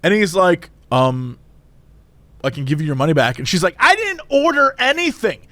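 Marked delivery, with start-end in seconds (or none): 0.9-3.4 low, hesitant cashier; drive-through guy. Retold or imitated. Cashier answering an angry customer, low and subdued. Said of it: "yelling at this poor guy". 3.8-5.5 drawn-out, indignant lecture, pitched up; full Karen. Retold or imitated. Woman lecturing cashier about wasted time, indignant. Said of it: "she's going full Karen".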